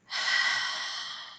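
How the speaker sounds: long breathy sigh out; demo sigh. Shown as a demonstration. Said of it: "make a big sigh sound like this".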